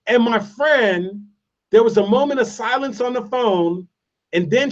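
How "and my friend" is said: usual speaking voice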